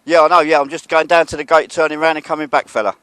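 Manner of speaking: loud, high and quick, reassuring call across the yard. Real, not acted. Vlogger shouts back to the security worker that he's only going to the gate, loud and quick. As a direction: shout it over so he hears you're leaving.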